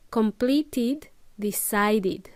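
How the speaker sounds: "Completed, decided" with enunciated final "id"; id ending. Shown as a demonstration. Teacher drilling last syllable, clear id endings. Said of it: "make that last syllable id".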